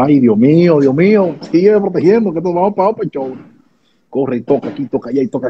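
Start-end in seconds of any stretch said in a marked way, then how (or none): 0.0-3.3 excited prayer. Retold or imitated.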